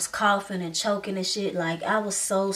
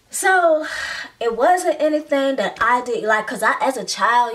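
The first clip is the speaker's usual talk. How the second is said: heated; 4 semitones higher, 6 dB louder than usual. Real, not acted. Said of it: "emphatic rant".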